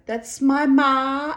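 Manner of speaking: put-on loud, slow, high Thai-accented voice; imitated Thai accent. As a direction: mimic a friend's Thai accent, drawn out. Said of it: "in her Thai accent".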